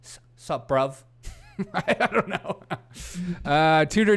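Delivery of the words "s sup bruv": tentative mock British greeting; British slang accent. Trying out UK talk on viewer, mock British. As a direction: try out a clumsy British accent. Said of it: "I think that's the U K talk".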